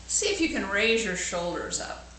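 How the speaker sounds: plain reading voice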